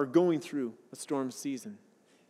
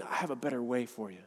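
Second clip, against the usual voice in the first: loving God; 8 semitones lower, 9 dB quieter than usual. Retold or imitated. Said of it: "in his love saying".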